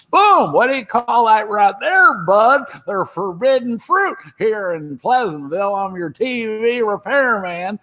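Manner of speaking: old-man actor impression, high and slow; Don Knotts. Shown as a demonstration. Comic demonstrating his film looping, slow elderly impression. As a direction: stretch the words in a high old man's voice. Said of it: "I had to imitate him as an old guy".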